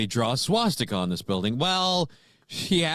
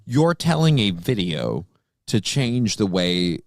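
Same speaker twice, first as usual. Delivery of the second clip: lower, slow talking himself down; inner scolding voice; 8 semitones lower than usual. Retold or imitated. Man reminding himself he's yelling at a video, low and slow. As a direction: lower and slow, like reasoning with yourself. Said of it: "tell myself in my brain".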